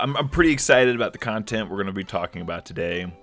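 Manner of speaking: ordinary talk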